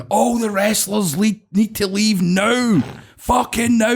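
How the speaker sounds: high, long-drawn mock holler; imitated hollering. Security guards banging on hotel doors, imitated high drawn-out shout. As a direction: call it down a corridor, stretching every word. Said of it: "banging on the doors going".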